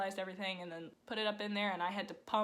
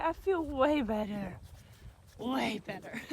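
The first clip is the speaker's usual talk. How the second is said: horse's voice; 3 semitones higher, 5 dB louder than usual. Pretend. Owner voices her recovering horse, squeaky and pleased. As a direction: give the mare a silly happy voice. Voicing the horse feeling better.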